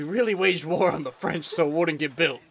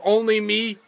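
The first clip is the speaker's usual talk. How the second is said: ordinary talk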